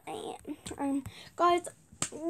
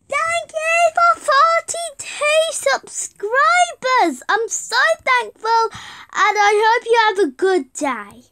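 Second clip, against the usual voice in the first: higher, louder, slow gushing thanks; overjoyed much higher and louder thanks; thrilled thanks; 8 semitones higher, 9 dB louder, slower than usual. Real, not acted. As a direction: beam out gratitude to the subscribers. Thanking 42 subscribers.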